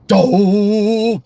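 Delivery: long, high, exasperated exclamation; Homer's drawn-out grunt. Retold or imitated. Showing the first take of Homer's catchphrase, drawn-out annoyed grunt. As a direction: hold the vowel as it climbs. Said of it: "Dan put that into Homer".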